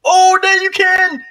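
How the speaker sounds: very high shrieking squeal. Real, not acted. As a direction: shriek it in mock terror. Reacting to helicopter danger.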